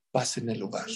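dropped soft, low-key reveal; hushed reveal. Shown as a demonstration. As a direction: let the answer fall softly. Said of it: "¿saben cuál es?".

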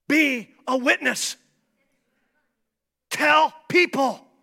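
fiery pulpit shouting; preaching at full force. Acted. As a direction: preach it hard to the crowd.

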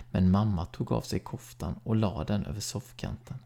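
ordinary delivery

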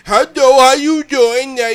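high, loud mock voice of a deaf woman greeting; mock her speech loudly and high; imitating her voice. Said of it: "That's how she talk".

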